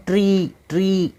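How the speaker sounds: ट्री, long drawn-out ई; stretched vowel. Shown as a demonstration. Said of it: "इसके लिए थोड़ा खींच रहे हैं".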